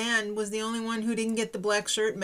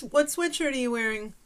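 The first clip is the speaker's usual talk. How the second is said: ordinary delivery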